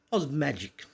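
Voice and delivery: plain reading voice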